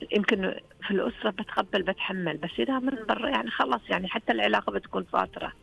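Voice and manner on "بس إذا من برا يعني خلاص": normal talking voice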